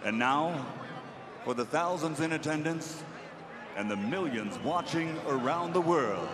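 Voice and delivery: booming boxing ring announcer. Acted. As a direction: announce it to the arena.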